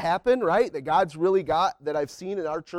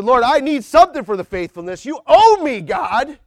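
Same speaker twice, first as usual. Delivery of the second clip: higher and louder, insisting God owes you; mock demanding prayer; 7 semitones higher, 10 dB louder than usual. Preacher imitating a believer demanding reward from God, loud and high. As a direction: push it like a spoiled claim.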